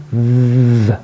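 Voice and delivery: TH buzzed with the voice, tongue out; voiced th. Shown as a demonstration. Teacher showing the sound in them, voiced buzzing TH. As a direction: hold a vibrating TH. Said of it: "This is the one that vibrates".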